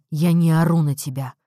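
loud, low-voiced angry denial; growl through teeth. A narrator's reading. Husband denying to wife that he yells, growled. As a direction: force it through gritted teeth. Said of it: "рычит он сквозь стиснутые зубы".

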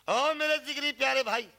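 high, loud calling out to a dear brother; desperate call. Acted. Dying Ali Jaan calls to friend Mohan, loud desperate cry. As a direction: cry out to him for help.

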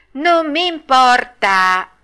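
high, loud, drawn-out retort; stubborn defiance. Acted. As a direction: drag it out like a bratty child. Pinocchio defies the fairy again.

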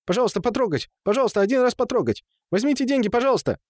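high, insistent gabbled pleas; high-pitched begging. A narrator's reading. Темул offers the women coins to touch, high frantic pleading. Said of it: "высокий голос, бурно тараторивший".

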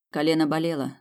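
ordinary delivery, nothing marked

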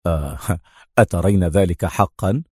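easy, unbothered reply with a smile; calm, smiling. A narrator's reading. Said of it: "ابتسم وهو يقول في هدوء".

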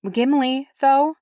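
high-pitched, sceptical question; doubtful grandmother. A narrator's reading. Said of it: "wrinkling her nose".

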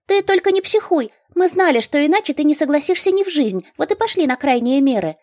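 high, rapid gnome voice; gnome chatter. A narrator's reading. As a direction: chatter fast like a little gnome. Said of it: "быстро-быстро затараторила гномка".

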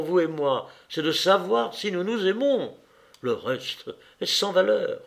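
high, loud, defiant outburst; almost shouting. A narrator's reading. Anna defying her lover's objections, almost shouting. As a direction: protest passionately, voice pitched well up. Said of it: "dit-elle presque en criant".

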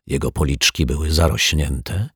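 ordinary delivery

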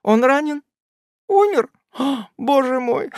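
rising panic, near hysteria; hysterical fright. A narrator's reading. Aunt panics, fearing Tupman is dead.

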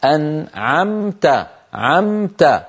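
slow أنعمت with the ن clearly sounded before ع; clear nun. Shown as a demonstration. Teacher demonstrating izhar in أنعمت. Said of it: "لذلك النون مظهرة".

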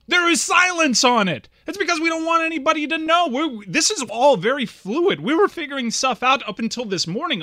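loud, high-pitched argumentative retort; exasperated rant. Retold or imitated. Answering Greg's claim about the secret, loud exasperation.

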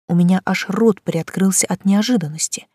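usual speaking voice

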